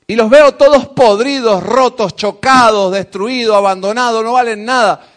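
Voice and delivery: loud, emphatic preaching; fervent preaching. Acted. Preacher warning the church about the world, loud and fervent. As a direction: drive the warning home.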